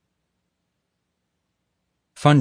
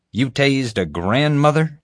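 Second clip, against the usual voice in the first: slow, higher, aghast question; horrified; 7 semitones higher than usual. Acted. Boss learning a grandmother was tased, horrified. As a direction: draw it out in disbelief. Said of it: "exclaimed, horrified".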